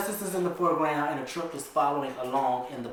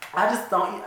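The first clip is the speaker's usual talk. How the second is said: normal talking voice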